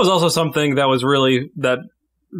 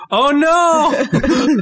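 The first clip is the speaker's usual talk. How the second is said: high, loud, laughing gasp of alarm; a hammed-up nature-show cry of alarm; playfully horrified, laughing through it; mock dismay; 12 semitones higher, 4 dB louder than usual. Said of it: "You nature documentary us".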